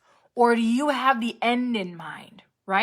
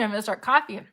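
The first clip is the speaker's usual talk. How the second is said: ordinary talk, nothing marked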